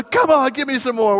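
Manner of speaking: high, loud dare to the waves; taunting call. Retold or imitated. Daughter calling out to the waves, high and loud. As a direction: challenge the sea like a little girl. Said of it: "And she's going".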